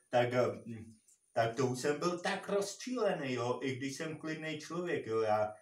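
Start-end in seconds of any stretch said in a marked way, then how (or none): none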